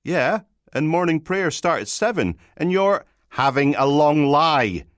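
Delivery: high, loud complaining voice; chiding young constable. Acted. Constable rings his sleeping sergeant about morning prayers, high chiding voice. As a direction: pipe up high with a reproach.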